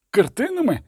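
a high, disbelieving question; incredulous. A narrator's reading. A soldier questions the order to burn paintings, incredulous. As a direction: ask as if he misheard. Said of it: "з недовірою запитав жовнір".

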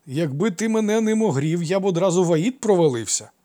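high, loud admiring exaggeration; animated boyish flattery; eager consoling. A narrator's reading. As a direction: cheer him up with big praise. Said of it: "поспішив утішити його Гермій".

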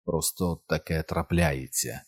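slow, soft and sorrowful; hushed, regretful admission; quiet, deep regret. A narrator's reading. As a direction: say it very softly, full of regret. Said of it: "мовив дуже тихо і з глибоким жалем".

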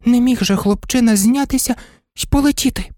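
high, tender-hearted doggy voice; little dog's thoughts. A narrator's reading. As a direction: talk to an absent friend in a high little voice. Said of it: "подумав Курдуплик". (високий голосок песика, що подумки говорить із другом)

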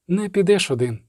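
ordinary delivery, nothing marked

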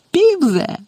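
very high-pitched gleeful cry; gleeful roar. A narrator's reading. Caretaker catching out the poltergeist, roaring gleefully. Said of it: "весело заревив Філч".

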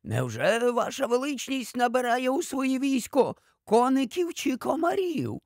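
high-pitched incredulous question; astonished. A narrator's reading. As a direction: rise into amused disbelief. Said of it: "здивувався доктор Корнеліус".

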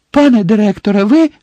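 high, loud, urgent question; startled hope. A narrator's reading. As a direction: call out in sudden anxious hope. Harry's heart jumps as Dumbledore stirs.

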